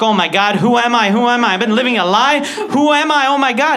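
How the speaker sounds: high, loud, frantic "who am I?"; rising panic about who you really are; panicking. Retold or imitated. Boy in an identity crisis after his friend's words, loud panic. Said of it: "I start panicking".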